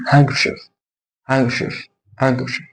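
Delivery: "هانكشيف" as "hang-ker-chif", no D; silent D. Shown as a demonstration. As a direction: drop the D from "هانكشيف". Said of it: "ما اقولش هاندكرشيف".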